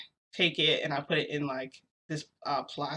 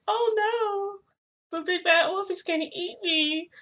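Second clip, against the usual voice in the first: much higher mock-scared cookie voice; retold gingerbread man; 14 semitones higher, 3 dB louder, slower than usual. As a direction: squeak it like a panicked gingerbread man, hamming it up. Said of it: "I pretended to be, like, a gingerbread man".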